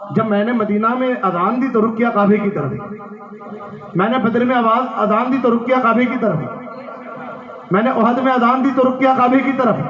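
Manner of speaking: pleading sermon cadence climbing in pitch; impassioned preaching. Acted. Preacher voicing Bilal asking his master where to face, rising fervour. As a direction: build line on line to a high peak, then drop to land it.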